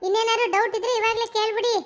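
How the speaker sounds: lightly voiced weary daughter-in-law; a hint of an irritated young wife; annoyed daughter-in-law. Wife pleading to be left alone.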